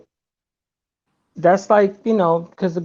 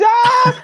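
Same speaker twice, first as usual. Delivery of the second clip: mock scream. Imitating the guy's scream.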